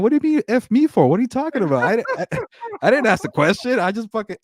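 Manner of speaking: high, loud exasperated rapper voice. Retold or imitated. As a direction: channel the rapper's outraged interview rant. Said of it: "It's like [name]".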